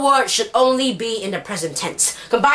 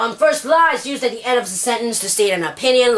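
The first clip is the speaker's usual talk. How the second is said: ordinary talk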